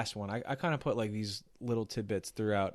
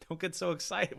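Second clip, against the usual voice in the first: quoting the line; 7 semitones higher than usual. Retold or imitated. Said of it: "You say".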